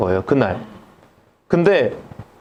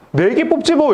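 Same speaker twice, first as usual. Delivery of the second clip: breezy, much higher toss-off; offhand; 9 semitones higher than usual. Retold or imitated. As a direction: say it like it's nothing. Dentist casually says pull four.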